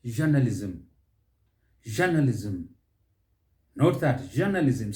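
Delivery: "Journalism" as JUR-nuh-liz-um; first-syllable stress. Shown as a demonstration. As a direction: lean on JUR in "Journalism", falling after. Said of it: "Stress is on that first syllable".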